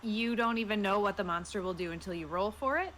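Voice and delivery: ordinary talk, nothing marked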